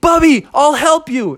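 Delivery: high, urgent call; helpful armadillo. Acted. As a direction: call out high, rushing to the rescue. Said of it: "armadillo ran up with a hose".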